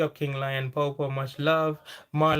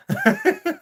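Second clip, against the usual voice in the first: loud high laugh; real laughter at a comment; high burst of laughter; 8 dB louder than usual. Real, not acted. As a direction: let a big laugh out.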